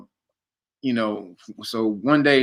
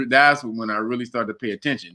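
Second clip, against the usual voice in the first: ordinary delivery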